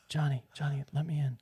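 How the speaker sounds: soft hushed pleading at the door; hushed mock sleepwalking mom; sleepwalker whisper.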